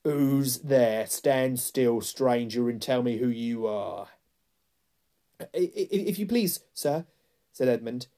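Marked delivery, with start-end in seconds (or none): 0.0-4.1 loud growled challenge; louder growling command to a stranger; growling wolf. A narrator's reading. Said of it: "said in a growling voice". 5.4-7.0 put-on high, stammering frightened boy; timid, high-pitched plea; trembling. A narrator's reading. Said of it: "trembling so that he could hardly speak".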